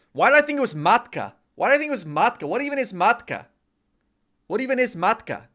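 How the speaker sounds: high, loud, fast disbelief at yourself; can't believe you got it wrong; real exasperated surprise, high and loud; incredulous. Real, not acted. Baffled by own mistake.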